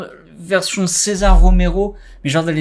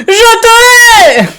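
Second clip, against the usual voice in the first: high, slow, drawn-out scream; screamed hatred; 12 dB louder, much slower than usual. Acted. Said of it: "avec une voix".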